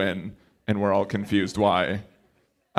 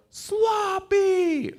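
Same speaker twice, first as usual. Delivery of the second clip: squeaky high one-word yell; mock crowd answer; 3 dB quieter than usual. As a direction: blurt it way up high like a silly password. Acting out the crowd's code-word reply.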